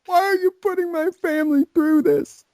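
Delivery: imitating the offended guy, high and tearful; mock whiny victim. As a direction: whine like an offended drama queen. Mocking Nuts whining.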